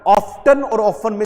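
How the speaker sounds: often said first with the t sounded, then without the t; wrong then right often. Shown as a demonstration. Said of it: "टी को silent कर दो". (often पहले टी बोलकर, फिर टी silent करके)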